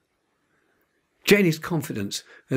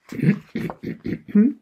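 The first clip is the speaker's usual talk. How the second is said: teacher's rising ahem; throat clearing; 10 semitones higher than usual. A narrator's reading. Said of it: "cleared her throat".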